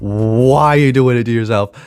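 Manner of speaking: usual speaking voice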